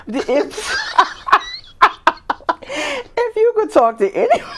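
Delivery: high-pitched, laughing question. Real, not acted. Interviewer attempting the next rapid question, cracking up. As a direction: try to ask it through helpless laughter.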